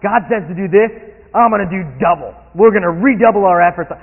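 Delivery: showy piety. Acted. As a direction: puff up with religious zeal. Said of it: "David is, is being more religious".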